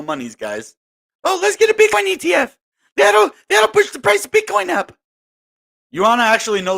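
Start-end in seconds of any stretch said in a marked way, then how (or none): 1.2-4.9 high squeaky mocking voice; shrill it like a clueless cheerleader; mock naive investor. Vlogger mocking ETF fans in a high squeaky voice.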